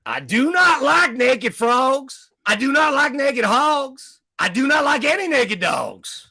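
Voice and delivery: Tennessee preacher rhyming in disgust; Tennessee accent. Acted. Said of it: "brought in the Tennessee accent".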